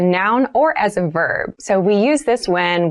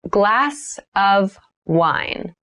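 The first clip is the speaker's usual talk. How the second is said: slow, drawn-out teacher demo; slow model read; much slower than usual. Shown as a demonstration. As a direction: stretch the phrase for learners. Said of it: "teacher models phrase, third take".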